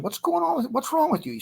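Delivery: very high voice for a baffled niece; imitated niece. Niece walking in on her crying boyfriend, high-pitched questioning.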